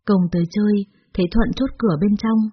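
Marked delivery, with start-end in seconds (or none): none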